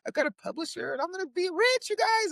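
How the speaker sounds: high-pitched mock boasting; giddy bragging. New author showing off to everybody, giddy bragging. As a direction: brag to everyone, pitch climbing with glee. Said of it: "I just was bragging to everybody".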